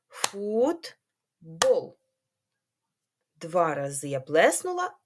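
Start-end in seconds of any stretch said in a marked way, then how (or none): 0.1-2.0 Футбол split into фут-бол with a clap on each; syllable clapping demo. Shown as a demonstration. Teacher has children repeat after her and clap. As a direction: say Футбол in two clear beats. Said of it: "Повторюйте за мною".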